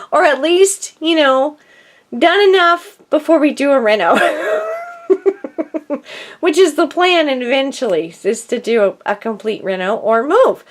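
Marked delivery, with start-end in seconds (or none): 4.2-6.3 laughing. Real, not acted. Laughs about reno plans.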